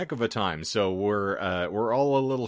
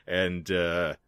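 ordinary talk, nothing marked

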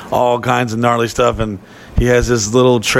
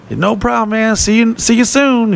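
cheery mayor; 11 semitones higher than usual. Retold or imitated. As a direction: call out like a jolly small-town mayor. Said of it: "He's like".

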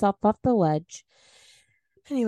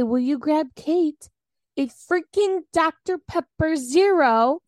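exasperated demand; 6 semitones higher, 5 dB louder, slower than usual. Retold or imitated. Exasperated request to husband.

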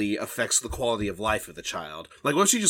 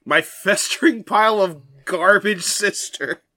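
mock disdain; 6 semitones higher, 5 dB louder, slower than usual. Joking insult as the fairy.

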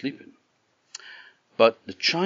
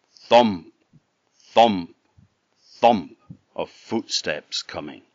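plain reading voice

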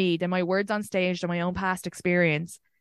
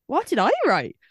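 higher, mock-incredulous reaction; retold bewilderment; 6 semitones higher, 4 dB louder than usual. Woman recounting her reaction to a strange message, bewildered. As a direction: act out baffled disbelief.

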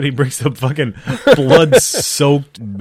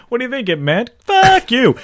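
high, hammed-up voice of the translator, jumping higher and louder on the curse; mock translator voice; 13 semitones higher, 4 dB louder than usual. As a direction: play the sly translator in a squeaky mock voice. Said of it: "He's like".

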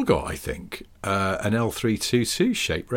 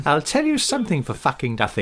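mock geezer Queen; 7 semitones higher than usual.

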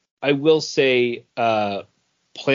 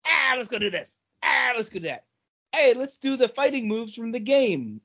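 higher, hammed-up eager hero chatter; mock hyped heroes; 7 semitones higher, faster than usual. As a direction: parody the characters' gung-ho lines. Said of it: "and it was like".

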